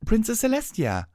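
loud high delighted call; joyful cry. Acted. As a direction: cry out her name in joy. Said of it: "Twilight cried out".

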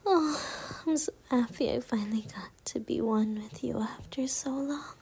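ordinary talk

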